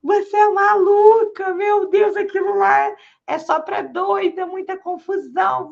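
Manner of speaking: aghast, as if nearly falling off the chair; shocked boss. Retold or imitated. Boss hearing where the intern is going, high and loud in shock. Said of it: "Ele quase caiu da cadeira".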